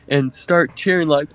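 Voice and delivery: ordinary delivery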